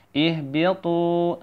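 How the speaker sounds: "Ihbitu" slowly with a lengthened final tu; deliberate chanted "Ihbitu"; long tu. Shown as a demonstration. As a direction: hold the tu of "Ihbitu" for two beats. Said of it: "Tu-nya dipanjangin dua harokat".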